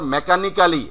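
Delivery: মেকানিক্যালি with a full "-ka-li" ending; local mispronunciation. Shown as a demonstration. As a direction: say every syllable, "me-ka-ni-KA-li", for মেকানিক্যালি. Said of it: "এটা প্রচুর বলে থাকি আমরা".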